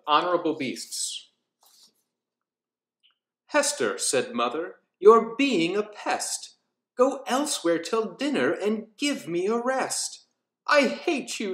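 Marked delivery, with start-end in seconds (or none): 3.5-10.2 rhythmic storybook reading with character voices; lilting Halloween storyteller; story narration. A narrator's reading. Reads nursery rhyme aloud. 10.6-11.5 put-on a girl snapping at her mother, understated; a touch of a childish scream; mild mock scream. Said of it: "screamed Hester from deep in her chest".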